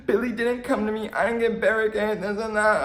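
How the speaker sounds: high, mocking whine; whiny brat. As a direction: mimic a spoiled girl complaining. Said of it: "She was like".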